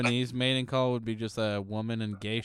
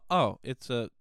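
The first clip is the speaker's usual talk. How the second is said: usual speaking voice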